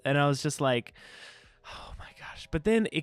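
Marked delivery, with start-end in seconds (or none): 1.7-2.5 breathy whispered groan; whispered exasperation. Retold or imitated. Fed-up viewer whispering at yet another fake ending. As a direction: whisper it in disbelief.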